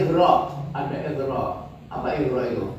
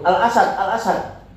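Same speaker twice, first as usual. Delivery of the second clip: higher, louder alarm call; warning shout; 6 semitones higher, 4 dB louder than usual. Shown as a demonstration. Teacher shows students how to warn of a lion, shouting high. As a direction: yell it as a warning to run. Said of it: "Awas, macan".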